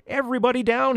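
urgent high-pitched yell. A narrator's reading. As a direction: cry out a warning to everyone. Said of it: "he yelled".

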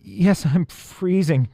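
high, drawn-out shivery voice; chattering with cold. A narrator's reading. Freezing elf answering her friend, teeth chattering. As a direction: stretch it out through chattering teeth. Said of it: "chattered the elf".

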